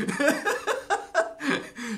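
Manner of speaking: high, very loud laughter; amused laugh. Real, not acted. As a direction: roar at your own joke. Laughs at own joke.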